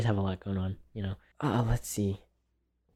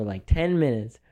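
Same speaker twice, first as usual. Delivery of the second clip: normal talking voice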